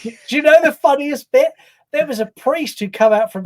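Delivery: excited. Real, not acted. Eager to tell funniest bit.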